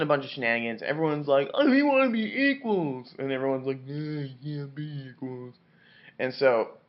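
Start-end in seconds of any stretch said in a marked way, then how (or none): 1.5-3.1 high, drawn-out mock protest; hammed-up slow plea, pitched way up; whiny crowd. Acted crowd demanding equality. 3.9-5.5 soft, slow put-on character voice; muffled, stretched-out caricature; dismissive reply. Acted dismissive answer to crowd.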